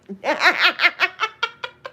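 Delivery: long high laugh. Real, not acted. Teasing friend about secret Superwoman title, high laugh. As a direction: crack up high and let it trail away. Insists she is not lying.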